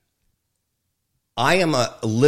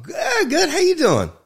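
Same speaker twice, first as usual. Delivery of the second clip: high, loud put-on cheerfulness; falsely bright reply to a friend's greeting; fake cheer; 5 dB louder than usual. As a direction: chirp it back like everything's fine. Faking cheer while depressed.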